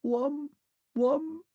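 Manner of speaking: the vanished machine man, distant echoing call. A narrator's reading. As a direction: let it ring from far away.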